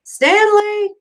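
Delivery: high, loud call across the camp. Acted. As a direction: call his name from a distance. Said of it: "he heard Mister Pendanski call".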